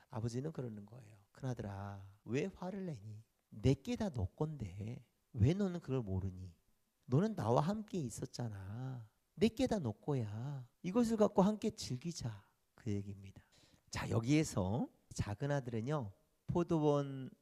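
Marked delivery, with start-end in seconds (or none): 1.3-12.4 gentle father. Acted. Father gently reassures his angry eldest son, soft. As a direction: soothe a hurt son quietly.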